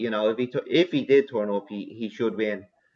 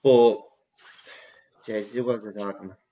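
ordinary talk, nothing marked

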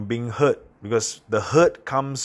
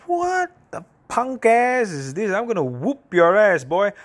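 mock tough threat; 10 semitones higher, 3 dB louder than usual. Retelling an imagined comeback to a rude stranger, hammed-up tough-guy threat. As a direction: play a cartoonish tough guy squaring up.

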